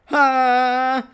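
loud high Ha, Dragon Ball style. Retold or imitated. Dragon Ball Z fight in his dream, high loud power-up shout. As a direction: scream a cartoon power-up.

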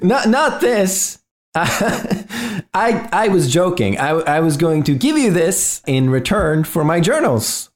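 high voice, laughing off the joke; amused intruder. Acted. Intruder explaining to Robert he was joking, amused.

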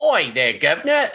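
mock cockney British greeting; hammed-up London British accent. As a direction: put on a broad street British accent. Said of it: "speaking in a British accent".

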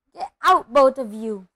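loud order, falling after the first burst; croaked order. A narrator's reading. Uncle sending both boys out, croaked loud order. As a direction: order them out forcefully. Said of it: "croaked Uncle Vernon".

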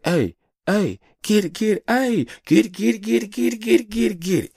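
high, loud hype-man chant; hype cheering. Retold or imitated. Dad cheering his dancing daughter on, high excited chant. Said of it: "I'm just like".

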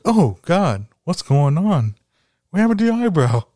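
teacher shocked at shaved eyebrow; mock shocked teacher. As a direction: gasp at the missing eyebrow.

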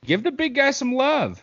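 high sing-song call for the big guy; high mock cheer. Calling for love for lineman.

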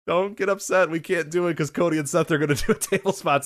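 high, pleading crowd appeasement; lightly hammed-up begging, with a laugh breaking in; mock placating. Wrestlers telling the crowd there's no table, mock placating.

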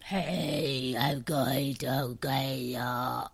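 very low, slow put-on demon growl; demonic voice. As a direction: drop way down into a clumsy demon voice. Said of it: "I was trying to do a demonic voice".

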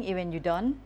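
ordinary delivery

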